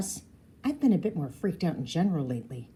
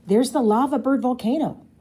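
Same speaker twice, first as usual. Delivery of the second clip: excited; 6 semitones higher, 7 dB louder than usual. A narrator's reading. Pointing out the volcano.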